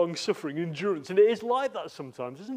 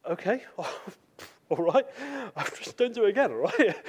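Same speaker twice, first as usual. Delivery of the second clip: laughing; 6 semitones higher than usual. Retold or imitated. Bill forgiving with a laugh.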